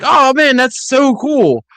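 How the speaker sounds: high, drawn-out excited exclamation; mock-gushing reaction. Host gushing over the Gotham connection, excited.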